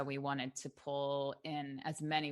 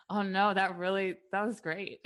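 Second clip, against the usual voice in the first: higher, louder, playful exaggerated gush; mock gushing; 5 semitones higher, 6 dB louder than usual. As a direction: gush it back, tongue in cheek. Mock reply to wig critics.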